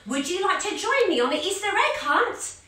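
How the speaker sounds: high bright hello on the phone; excited phone greeting. Acted. Bunny calls Charlie Spring Chick, high excited hello. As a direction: greet your friend eagerly on the phone. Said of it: "ring my friends".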